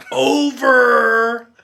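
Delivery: radio sign-off, high and loud; "Over." barked like a walkie-talkie call. Retold or imitated. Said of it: "Were you talking like a walkie-talkie?".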